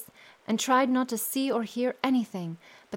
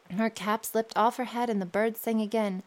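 usual speaking voice

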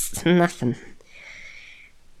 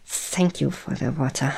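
ordinary talk

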